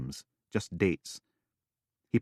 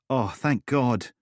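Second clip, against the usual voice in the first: relief; 6 semitones higher, 4 dB louder, slower than usual. Acted.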